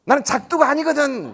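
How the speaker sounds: high, loud comic comeback; exasperated retort. Retold or imitated. Official losing patience with parent, exasperated retort.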